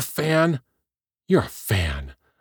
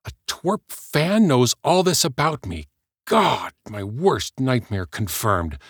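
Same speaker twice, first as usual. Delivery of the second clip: higher and louder, building to a tirade; rising outrage; 7 semitones higher, 3 dB louder than usual. Acted. Heather raging at the fan who knows her secrets, outraged. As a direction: let the panic spill into fury.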